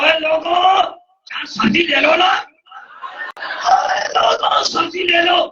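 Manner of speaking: a lightly voiced street vendor shouting his wares; calling out loudly. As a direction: call the street like a vegetable seller. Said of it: "زور زور سے کہنے لگا".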